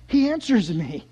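lifted, full-voiced wonder; astonished declaration; amazed realization. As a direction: bring it out aloud as dawning amazement. Repeats line aloud in amazement.

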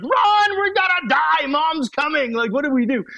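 high, loud panicked cry to flee; panicked kids. Retold or imitated. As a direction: yell like a kid who knows mom is coming. Kids panic as mom approaches.

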